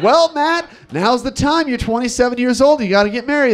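high-pitched mock lady teacher. Lady teachers pushing single colleague to marry, mock nagging. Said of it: "all the lady teachers".

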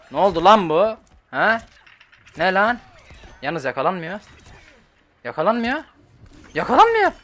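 high, loud disbelief climbing to a shriek; real outburst, escalating with each repeat; exasperated yelling. Real, not acted. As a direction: yell in mounting frustration at the game. Opponent cannot be grabbed.

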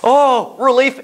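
relief. Retold or imitated. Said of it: "the first person that went".

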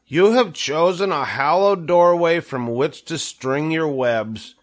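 high, earnest oration, projected; formal speech. A narrator's reading. Wilbur addresses Charlotte's daughters, speechmaking earnestly. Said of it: "make a short speech on this very important occasion".

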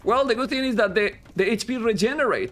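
lifted, energetic esports commentary; casting voice. Real, not acted. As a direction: call the game like a hyped caster. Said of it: "I changed my voice for casting".

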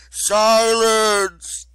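huge, high, loud bellow; furious roar. A narrator's reading. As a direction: roar it as an enraged goddess. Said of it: "The force of Gaia's anger".

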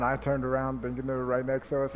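ordinary talk

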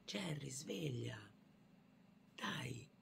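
slow whisper to a sleeping teddy; hushed call to a toy bear; soft wake-up whisper. Pretend. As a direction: coax the bear awake in a hush.

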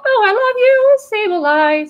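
high, loud delighted gush over a card; excited squeal. Real, not acted. Collector thrilled to pull Sableye, high excited squeal.

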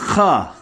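ha with a scraped back-of-throat kh; rasping H. Shown as a demonstration. Clearing up a letter children confuse, rasping ha. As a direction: rasp the h of ha. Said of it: "kırıltılı".

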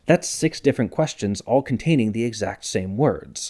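usual speaking voice

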